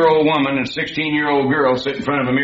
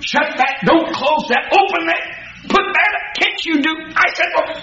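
put-on high, rapid snapped orders at the kids; mock shrill parent barking commands; yappy nagging parent; 14 semitones higher than usual. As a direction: nag the kids like a yapping little dog. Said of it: "talking like that little dog".

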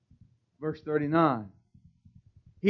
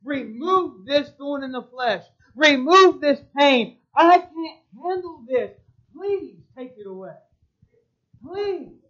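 begging; 6 semitones higher, 9 dB louder than usual. Acted. Paul begging the Lord to remove his thorn, higher desperate plea. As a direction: beg God to take the pain away. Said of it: "I begged the Lord three times".

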